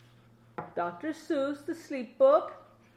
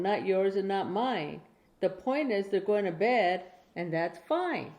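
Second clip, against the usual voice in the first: put-on reading to a child; 4 dB louder than usual. Grandma reading a Dr Seuss bedtime book to her grandchild, clear storytelling. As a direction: read it out like a bedtime book for a little one.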